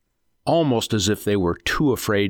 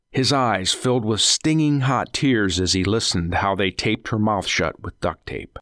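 plain reading voice

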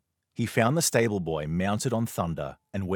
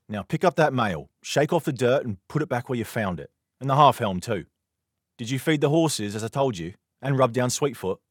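louder, higher brisk orders; ordering; 6 semitones higher, 6 dB louder than usual. Acted. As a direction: command the boy to tidy up. Knight orders boy about.

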